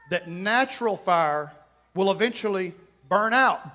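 ordinary delivery

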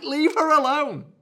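high, drawn-out yell; exasperated mock shout. Commenters telling the boyfriend to back off, exasperated shout. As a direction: build to a loud, hammed-up bellow.

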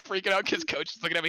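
ordinary delivery

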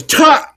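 sudden yell. Pretend. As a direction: blast out a yelp. Yelled song blast.

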